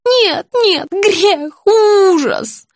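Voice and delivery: high, horrified cries; agitated old woman. A narrator's reading. Patient horrified at her own sin, agitated. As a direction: cry out in horror, drawing out the end. Patient frantically denying, voiced.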